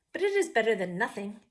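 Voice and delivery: ordinary delivery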